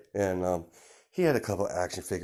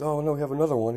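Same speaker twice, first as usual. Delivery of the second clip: higher, louder sudden surprise; doctor surprised by a second twin, higher and louder; 8 semitones higher, 6 dB louder, faster than usual. Retold or imitated. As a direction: blurt out the news of another baby.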